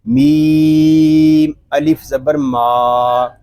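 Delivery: slow, drawn-out letter-by-letter recitation; Quran spelling drill. Shown as a demonstration. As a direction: spell out each letter, stretching it slowly. (धीमे, खींचकर हिज्जे करते हुए)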